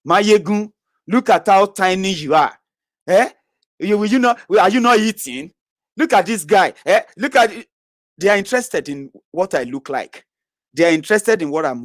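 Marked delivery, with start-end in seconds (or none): 0.1-7.7 high, loud taunting of a critic; mock taunt, higher and louder. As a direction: sneer at how tiny he looks, pitched up. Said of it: "who would rather ask me".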